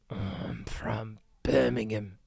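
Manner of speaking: slow, whispered mock Birmingham accent. Streamer repeats the Birmingham local's line, whispered in a Birmingham accent. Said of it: "whatever the Birmingham accent is".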